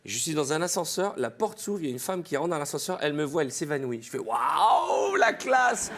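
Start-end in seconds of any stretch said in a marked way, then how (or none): 4.2-5.9 hammed-up "waouh" swelling to a shout; boastful delight. Man retelling a woman fainting at the sight of him, crowing in delight.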